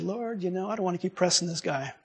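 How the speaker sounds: very soft, hushed aside to God; retold private prayer. Man to the Lord about not pressing, hushed private prayer. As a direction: murmur it as an inward confession. Silent prayer during the story.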